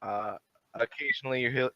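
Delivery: normal talking voice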